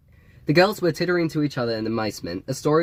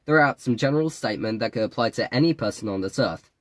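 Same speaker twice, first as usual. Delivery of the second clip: usual speaking voice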